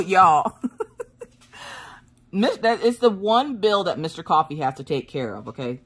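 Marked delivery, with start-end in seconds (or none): none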